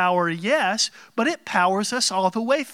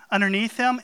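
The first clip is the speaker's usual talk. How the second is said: ordinary talk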